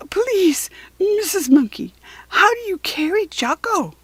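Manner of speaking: high, breathless squeaky plea; breathless squeak. A narrator's reading. Katy out of breath asks Missus Monkey, squeaking high. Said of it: "she managed to squeak".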